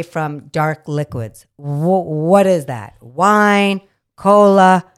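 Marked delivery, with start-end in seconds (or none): 1.6-4.8 loud rant. Real, not acted. Ranting about dark liquids.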